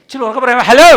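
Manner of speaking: loud high call to the crowd. Real, not acted. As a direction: call out to the back rows. Preacher calling out to crowd. (ഉറക്കെ വിളിച്ചു ചോദിക്കുന്നു)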